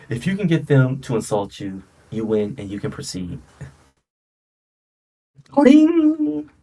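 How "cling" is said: high cling sound effect. Pretend. Imitating a game sound.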